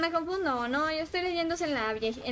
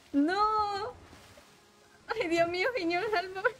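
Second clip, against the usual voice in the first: higher, half-laughing pleas for help; mock panic; 7 semitones higher than usual. Real, not acted. Streamer chased by enemies in game, playful panicked pleading.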